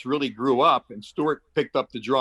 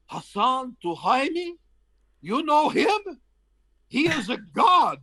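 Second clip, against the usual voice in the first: awestruck official; 12 semitones higher than usual. Retold or imitated. Airport official amazed that Miles knows the minister, high astonished voice. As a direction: play the stunned official who can't believe the name.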